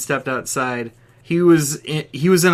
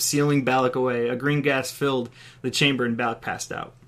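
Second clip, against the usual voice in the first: usual speaking voice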